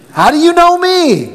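very high, astonished how do you know me; amazed question. Acted. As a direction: squeak it in astonishment.

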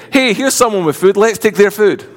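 mock eager. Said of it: "He says".

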